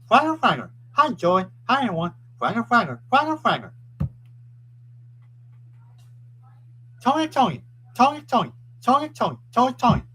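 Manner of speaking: high, loud repeated name chant; calling out. Real, not acted. Streamer hailing Joy and everyone, high excited calling. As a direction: call the names out high, falling at the ends.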